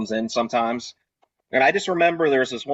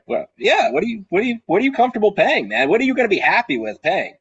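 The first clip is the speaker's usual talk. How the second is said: eager bartender; 10 semitones higher, 4 dB louder than usual. Retold or imitated. Acting himself pushing the customer.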